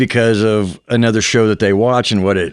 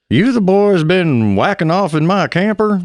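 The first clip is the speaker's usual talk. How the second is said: higher cranky old neighbor impression; 9 semitones higher than usual. Doing his son's bad Hank Hill for the guys, old neighbor voice. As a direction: play the grumpy old guy next door. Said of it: "old guy that was Beavis and Butthead's neighbor".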